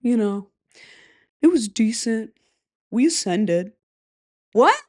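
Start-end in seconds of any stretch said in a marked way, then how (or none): 1.4-3.7 flat, deadpan and unbothered; monotone voice. Retold or imitated. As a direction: say it like nothing happened. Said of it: "The most monotone voice I've ever heard".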